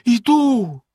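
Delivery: despairing. A narrator's reading. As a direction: answer in desperation. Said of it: "розпачливо сказала дружина".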